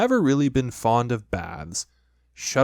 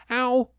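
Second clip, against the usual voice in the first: squeaky ow; 13 semitones higher than usual. A narrator's reading. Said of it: "he said".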